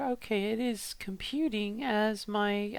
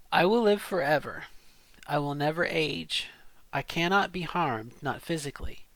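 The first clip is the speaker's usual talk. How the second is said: dramatic story reading; 5 semitones lower than usual. Acted. Reading a passage aloud into the pitch app, story narration. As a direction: tell it like a confession of a dark deal. Reads the next paragraph.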